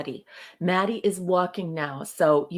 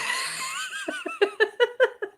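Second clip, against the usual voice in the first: high laughter. Real, not acted. Laughing at the joke.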